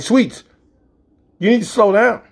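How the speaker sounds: high, loud shout at the driver; panicked yell. Retold or imitated. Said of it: "you cannot yell at a driver".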